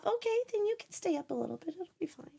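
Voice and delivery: hushed conspiratorial whisper; quick, sneaky whisper. Acted. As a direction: whisper it like a secret. Conspiratorial permission to chat.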